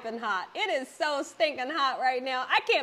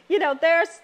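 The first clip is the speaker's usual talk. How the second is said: laughing; 6 semitones higher, 6 dB louder than usual. Real, not acted. Laughs at the bubbles.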